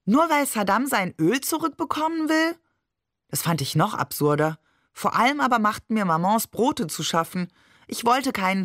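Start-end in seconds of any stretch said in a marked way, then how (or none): 0.1-2.6 high, rising incredulous question. A narrator's reading. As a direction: question it in childlike disbelief. Child finds the argument absurd.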